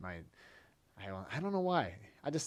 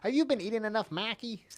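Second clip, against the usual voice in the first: put-on high-pitched fussing relative, accented; pitched-up family accent, R dropped; imitated relatives' accent; 8 semitones higher than usual. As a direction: fuss in a mock grandmotherly accent. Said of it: "and my, my, my relatives".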